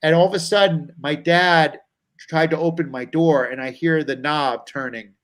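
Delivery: usual speaking voice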